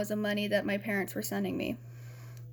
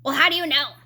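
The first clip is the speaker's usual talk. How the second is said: louder, higher, sceptical roommate's comeback; challenging retort; 4 semitones higher, 8 dB louder, faster than usual. Retold or imitated. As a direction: throw the question back sharply, imitating her. Roommate challenges her miscarriage claim.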